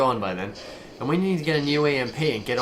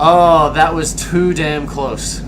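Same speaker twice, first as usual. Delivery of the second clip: slow, louder, breathless relief; relieved gasp; 8 dB louder, much slower than usual. Acted. Pilot after the narrow escape, relieved. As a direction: let the tension out.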